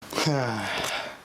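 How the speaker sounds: long falling sigh; a real sigh before starting over. Real, not acted. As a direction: let out a slow audible sigh. Sigh alone before new segment.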